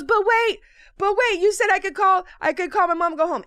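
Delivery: lightly acted anxious objection; pleading girl. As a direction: plead urgently, hurrying toward the end.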